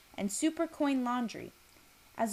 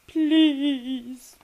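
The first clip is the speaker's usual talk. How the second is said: a loud, pleading please; pleading cry; 5 semitones higher, 10 dB louder than usual. Acted. Recorded man whimpering, pleading.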